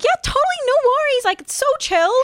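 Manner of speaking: high bubbly fake-nice voice; overly polite American. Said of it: "Americans will be like".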